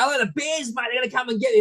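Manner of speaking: a hint of excitable wildlife man; Steve Irwin. Tourist sees bears in Canada, mock croc hunter. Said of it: "Do not do Steve Irwin impersonations".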